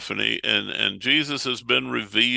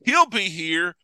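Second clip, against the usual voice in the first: lightly voiced hopeful traders, higher; a touch of acted glee, raised pitch; pleased money changers; 6 semitones higher than usual. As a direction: play mildly eager merchants expecting good business. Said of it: "they're like".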